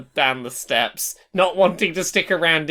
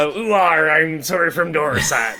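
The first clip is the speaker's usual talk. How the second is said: broad rural Dorset farmer accent, hammed up; exaggerated Dorset accent. Shown as a demonstration. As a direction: lay the West Country burr on thick. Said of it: "do the exaggerated version of your area".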